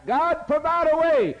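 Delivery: high, loud desperate plea; Moses crying out. Acted. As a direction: cry out to God for rescue. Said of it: "And Moses cried".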